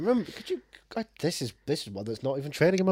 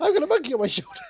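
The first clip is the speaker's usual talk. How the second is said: very high-pitched comic whine; silly high put-on voice; hammed-up sitcom character; 14 semitones higher, faster than usual. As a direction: squeak it as a mock sitcom line. Plays a sitcom character interrupting.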